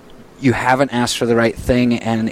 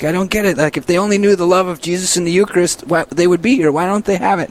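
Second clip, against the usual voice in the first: high-pitched, exasperated dad impression; frustrated; 7 semitones higher than usual. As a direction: plead in mock bafflement, pitched up. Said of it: "he gets frustrated".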